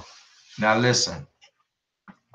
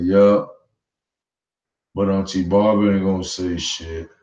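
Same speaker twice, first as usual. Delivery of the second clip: lower, casual retelling of the nephew; a hint of the nephew, said lower; imitating the nephew; 5 semitones lower than usual. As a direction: drop the voice and shrug it off like the nephew. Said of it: "That's exactly what he said".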